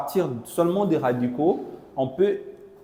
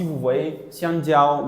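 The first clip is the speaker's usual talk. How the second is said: slow pointing out; much slower than usual. Shown as a demonstration. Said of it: "teacher points at the word".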